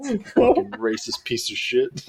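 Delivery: slow, soft grumble; hushed, drawn-out pretend muttering; muttered insult. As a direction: curse him under your breath. Muttered insult after innocent cover-up.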